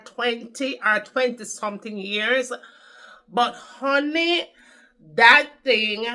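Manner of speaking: a hint of Jamaican patois storytelling; Jamaican accent. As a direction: slip into a lightly put-on Jamaican accent. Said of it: "let me talk in a Jamaican accent".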